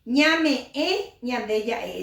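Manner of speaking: Ñame e with a clear nasal ñ at the start; nasal ñ onset. Shown as a demonstration. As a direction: open ñande ya e on a nasal ñ. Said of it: "Esa es la forma correcta".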